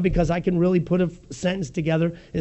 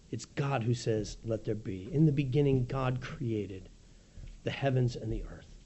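hushed solemn; 5 semitones lower, 8 dB quieter than usual. Acted. Solemn reading of creation.

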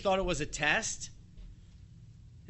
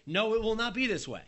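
Peter refusing; 7 semitones higher, 4 dB louder than usual. Acted. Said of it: "step out there and say".

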